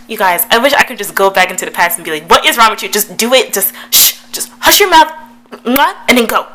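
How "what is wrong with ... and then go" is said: raised voice, exasperated telling-off; mock scolding. Vlogger telling her past self to just kiss, mock scolding. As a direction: scold yourself impatiently, building higher and louder.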